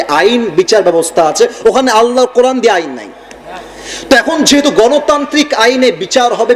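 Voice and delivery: usual speaking voice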